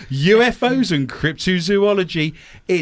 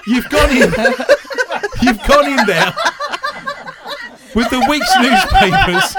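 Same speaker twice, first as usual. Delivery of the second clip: higher and louder, laughing through it; laughing tease; 9 semitones higher, 5 dB louder than usual. Real, not acted. Host mocks co-host's strained face, laughing. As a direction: tease her while cracking up.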